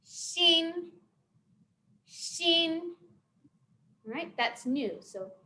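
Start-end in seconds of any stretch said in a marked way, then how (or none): 0.0-2.8 "Xin" held high and flat, twice; level high tone. Shown as a demonstration. Teacher leading learners through the word for new, high level tone. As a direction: chant "Xin" on one steady high note. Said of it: "go like this".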